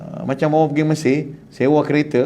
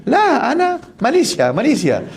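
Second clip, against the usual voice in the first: pilgrim protesting; 8 semitones higher than usual. Retold or imitated.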